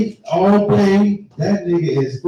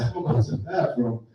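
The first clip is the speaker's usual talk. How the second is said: quiet; 5 semitones lower, 8 dB quieter than usual. Retold or imitated. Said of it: "He said".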